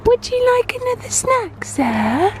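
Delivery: put-on high sing-song baby talk. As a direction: coo at the baby. Talking to baby son.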